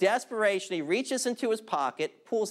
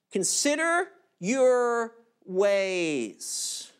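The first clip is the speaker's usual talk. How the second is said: slow solemn command; 2 semitones higher, much slower than usual. Shown as a demonstration.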